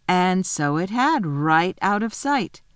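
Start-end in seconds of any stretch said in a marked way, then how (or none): none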